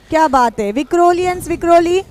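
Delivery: ordinary talk, nothing marked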